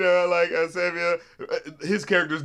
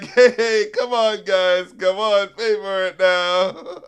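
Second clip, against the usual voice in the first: loud imitated plea to customers; harassed store clerk; 3 semitones higher, 6 dB louder, much slower than usual. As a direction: plead loudly as the put-upon clerk. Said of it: "they're being so shitty to him, all up in his face. And he's like".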